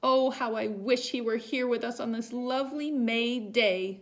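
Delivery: high, loud, longing sigh of a wish; wistful mother. A narrator's reading. Mother wishing for her lost son, high and longing. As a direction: wish him back with longing. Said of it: "said Missus Duncan".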